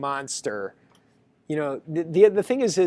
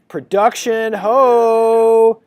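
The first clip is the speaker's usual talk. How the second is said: higher, much louder triumphant whoop; mock battle cry; 6 semitones higher, 8 dB louder, much slower than usual. Lecturer voicing his student launching production, whooping. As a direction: yell it like charging into battle.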